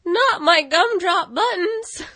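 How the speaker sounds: high, slow, squeaky cookie voice; Gingerbread Man. Retold or imitated. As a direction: squeal it like a terrified little cookie. Quoting Shrek's gingerbread man scene.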